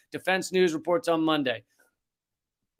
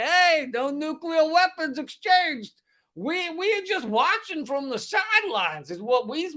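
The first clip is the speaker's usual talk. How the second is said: put-on wandering Brooklyn-Southern accent, pitched way up; unplaceable American accent; 7 semitones higher than usual. Comedian voicing the US as innocent bystander, in a muddled Brooklyn-Southern accent. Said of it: "I don't know where this accent is".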